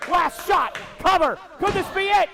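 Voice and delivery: rising to a loud high yell; excited building shout. Real, not acted. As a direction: build to a climax on the cover. Final cover, climax.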